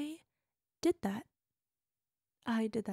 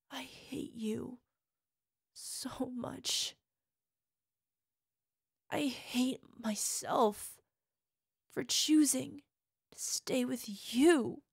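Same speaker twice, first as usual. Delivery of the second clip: hateful whisper; slower than usual. Acted. Partner confessing hatred of her lover, slow whisper. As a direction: breathe the hatred slowly.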